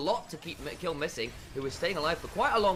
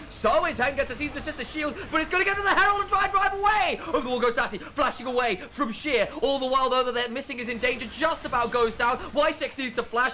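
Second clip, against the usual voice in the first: rising, louder live commentary; play-by-play; 10 semitones higher, 8 dB louder than usual. Real, not acted. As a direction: call the fight with building energy.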